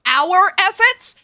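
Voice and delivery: loud, slow outraged echo; indignant shout. Real, not acted. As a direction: throw the words back in disbelief. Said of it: "I'm calm".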